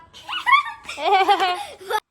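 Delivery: loud high laugh after scolding. Real, not acted. Mother laughing at her own threat. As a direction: burst out laughing.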